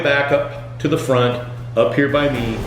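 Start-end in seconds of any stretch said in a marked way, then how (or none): none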